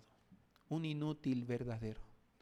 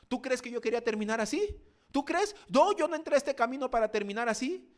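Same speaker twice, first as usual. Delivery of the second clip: higher, loud aggrieved reproach; complaining minister; 10 semitones higher, 7 dB louder, faster than usual. Retold or imitated. Fallen minister reproaching the preacher, loud aggrieved complaint. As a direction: hurl the complaint at the pastor. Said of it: "cómo me reclamaba a mí".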